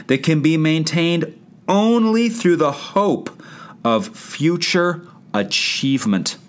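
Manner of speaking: slow, every word weighted, "only" and "future" stressed; slow weighty reading. Shown as a demonstration. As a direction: read it out slowly like a quote that matters. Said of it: "I'm gonna read that again as he writes it".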